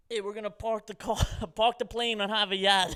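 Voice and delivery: mock Boston pilot, hammed-up; Boston accent. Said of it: "I wish I could do a better Boston accent".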